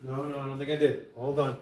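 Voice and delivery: ordinary talk, nothing marked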